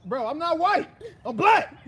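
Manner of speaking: high, loud indignant denial; playful outraged insistence; mock protest. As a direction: protest loudly, half laughing.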